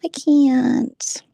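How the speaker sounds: high, whiny mock fear; high mocking imitation of a frightened woman; mocking scared. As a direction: squeak it like a scared mock victim. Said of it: "she's like".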